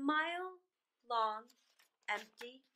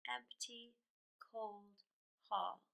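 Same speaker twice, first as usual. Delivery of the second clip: hushed; 5 semitones lower, 9 dB quieter, slower than usual. A narrator's reading. Narrator dwells on the cold.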